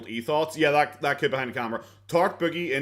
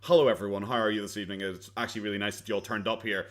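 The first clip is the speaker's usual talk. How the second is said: polite normal voice; 6 semitones lower, 4 dB quieter than usual. Retold or imitated. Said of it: "break character and say".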